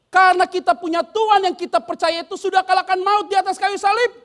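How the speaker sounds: loud, high preaching cry; fiery sermon climax; full-force preaching. Acted. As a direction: proclaim it to the whole hall.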